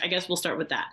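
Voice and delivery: usual speaking voice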